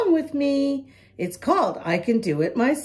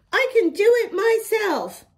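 Emily; 7 semitones higher than usual. A narrator's reading. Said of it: "Emily says".

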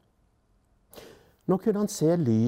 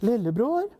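higher-pitched mother's call, rising at the end; light voice; 7 semitones higher than usual. A narrator's reading. Mother calls lillebror in the dark, light voice. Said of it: "Det var en lys stemme først".

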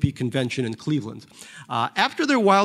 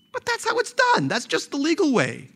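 much higher, protesting excuse; Barry's defensive voice; 13 semitones higher than usual. Acted. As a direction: brush it off as mere procedure.

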